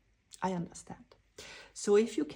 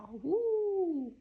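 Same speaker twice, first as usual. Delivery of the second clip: excited woo; 9 semitones higher, slower than usual. Real, not acted. Joyful cheering during a card reading.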